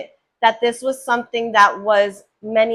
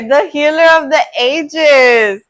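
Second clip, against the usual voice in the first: high, loud, thrilled proclamation; excited announcement; 4 semitones higher, 8 dB louder than usual. Real, not acted. Card reader reveals the healer card, excitedly announcing.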